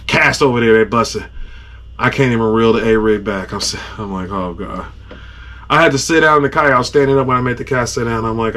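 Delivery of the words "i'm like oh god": much softer, low, drained; exhausted groan. Retold or imitated. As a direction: groan it like you're spent. Can't reel the line in.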